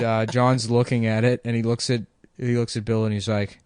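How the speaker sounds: usual speaking voice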